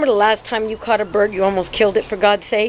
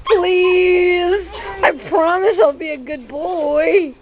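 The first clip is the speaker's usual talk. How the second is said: higher, whiny pleading with a cry in it, slow at the end; begging puppy voice; 7 semitones higher, slower than usual. Pretend. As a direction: beg mama like a puppy who wants the bird. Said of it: "He's like".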